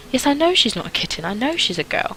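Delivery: put-on high-pitched child arguing her case; insistent little girl. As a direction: press the point like a determined child.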